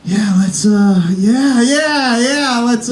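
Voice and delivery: forced, rising mock cheer; fake excitement. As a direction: pretend to be thrilled. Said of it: "act excited too".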